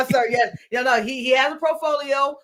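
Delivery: usual speaking voice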